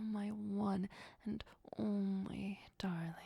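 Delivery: ordinary talk, nothing marked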